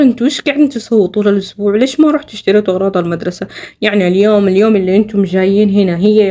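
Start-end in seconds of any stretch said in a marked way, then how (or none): none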